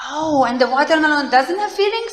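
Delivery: a teacher questioning the children in mock wonder; mock surprise. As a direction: play dumb to lead the kids on. Teacher plays dumb to kids.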